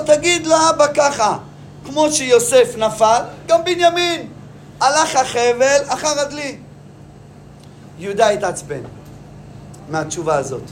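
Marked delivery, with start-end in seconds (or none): 0.1-6.6 high, slow taunting ruler; a lightly voiced Joseph spelling out the taunt; mocking Joseph. Joseph to Judah, a slow, higher mocking taunt.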